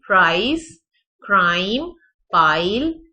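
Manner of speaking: प्राइस, क्राइम, पाइल with an ai diphthong; loud model words with a rising end; careful word demo. Shown as a demonstration. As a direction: say each word with an open ai that glides shut. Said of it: "इन सब में diphthong".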